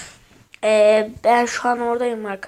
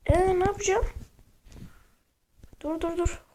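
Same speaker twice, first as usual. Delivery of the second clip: being chased; 5 semitones higher than usual. Real, not acted. Fleeing enemies in game.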